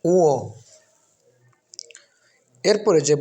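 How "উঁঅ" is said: উঁ as nasal u-o, louder; correct letter name. Shown as a demonstration. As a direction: glide firmly through উঁ without an m. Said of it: "সঠিক উচ্চারণ হচ্ছে".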